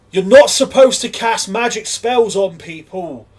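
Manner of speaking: loud, high, drawn-out telling-off; outraged scolding. Acted. Patron rounds on someone casting magic on people, loud outrage. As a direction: call them out loudly, slowly and indignantly. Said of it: "the person immediately turns and goes".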